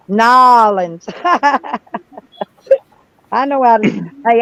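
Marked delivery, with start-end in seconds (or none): 0.1-1.0 slow, drawn "Nolens", NAH-lenz; Louisiana pronunciation. Shown as a demonstration. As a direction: lean into "Nolens" like a Cajun local. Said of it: "I about got the accent".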